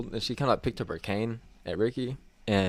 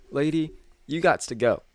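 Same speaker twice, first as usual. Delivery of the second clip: mock stern order; 7 semitones higher, 5 dB louder than usual. Boss kicking customer out.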